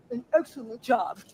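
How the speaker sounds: slow, timid, lightly voiced imitation of [name]; mocking [name]'s meek voice. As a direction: play it small and humble, drawn out. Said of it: "holding his arms and talking like that".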